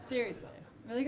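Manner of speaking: normal talking voice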